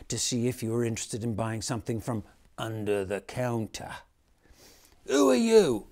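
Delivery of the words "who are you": put-on much higher, louder child's demand; sharp little girl. A narrator's reading. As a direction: snap it out like a bossy little girl. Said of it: "Mary asked sharply".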